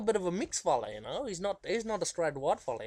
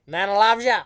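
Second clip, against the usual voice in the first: louder, higher loving granny voice; imitating Nana; 5 semitones higher, 12 dB louder than usual. Grandson recalls Nana's fond call, loud and high. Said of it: "Nana would always say".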